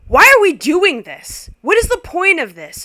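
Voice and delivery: loud, high outburst; scream. Retold or imitated. Guest screams at the family Seder. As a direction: yell it at the table. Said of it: "screams out loud".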